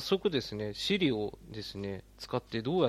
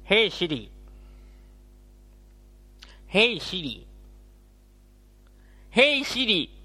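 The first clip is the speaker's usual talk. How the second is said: jaw thrust forward, higher and louder; jutting jaw voice; 7 semitones higher, 6 dB louder, slower than usual. Shown as a demonstration. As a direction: call out with an underbite voice. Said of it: "しゃくれで呼んでみる".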